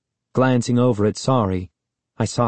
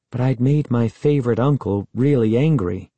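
usual speaking voice

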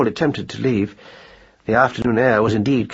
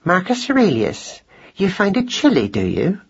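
higher, affronted wifely challenge; icy displeasure; 8 semitones higher than usual. A narrator's reading. Mouse wife challenges husband's complaint, icy. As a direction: pull him up by his full name, icily. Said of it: "in a voice that was positively icy".

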